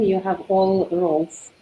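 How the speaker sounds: ordinary talk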